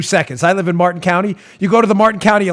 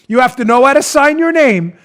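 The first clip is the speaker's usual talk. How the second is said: usual speaking voice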